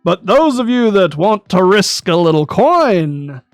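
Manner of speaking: carnival barker. Acted. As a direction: call out to passersby like a barker. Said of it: "he is yelling to the various passenger passing bys".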